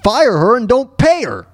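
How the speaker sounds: normal talking voice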